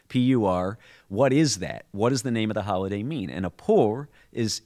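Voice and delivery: ordinary delivery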